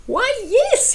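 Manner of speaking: high, cheerful knight answering; grinning. Acted. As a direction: say it with a broad grin. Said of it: "he grinned".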